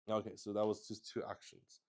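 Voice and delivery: ordinary talk, nothing marked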